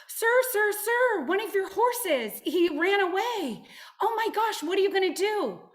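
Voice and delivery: high, loud, panicky cries of alarm; frantic workers. Acted. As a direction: shout up high like a frightened farmhand. Workers panic over lost horse.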